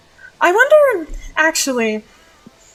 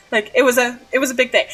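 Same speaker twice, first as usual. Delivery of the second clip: ordinary talk, nothing marked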